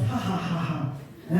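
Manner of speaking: low, soft acted chuckle; storyteller imitating a boy's amused ha-ha; hushed pretend laughter; amused mock laugh. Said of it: "he was so amused".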